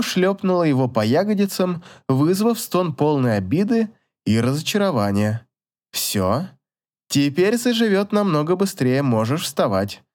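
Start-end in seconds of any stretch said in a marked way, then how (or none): none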